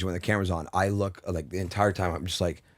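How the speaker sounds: ordinary delivery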